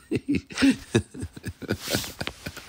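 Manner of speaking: airy high cackle; unvoiced high giggle; high breathy laugh. Real, not acted. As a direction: burst out laughing at the clip. Laughing at people on video.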